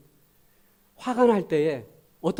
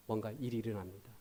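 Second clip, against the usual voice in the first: ordinary delivery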